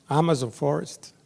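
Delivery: usual speaking voice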